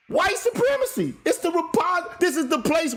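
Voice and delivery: high-pitched, incredulous outburst; flabbergasted. Real, not acted. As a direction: pitch shoots up in real disbelief. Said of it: "I am fucking flabbergasted by this shit".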